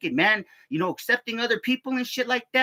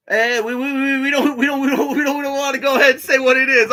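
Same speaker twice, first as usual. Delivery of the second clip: high, loud mock officer voice dodging; 6 semitones higher, 8 dB louder than usual. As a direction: mimic the nervous officer, higher and louder. Mimicking the army officer's evasion.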